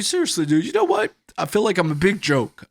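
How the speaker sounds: high, loud hurt dude voice; mock wounded friend. Hurt friend complaining about his home, high and aggrieved. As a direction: whine about being disrespected.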